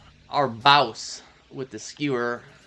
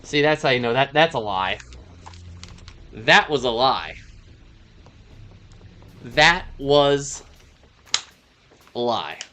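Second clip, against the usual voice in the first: hammed-up Australian accent; 8 dB louder than usual. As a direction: play a brash Aussie bragging loudly. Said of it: "I went from Australian to German".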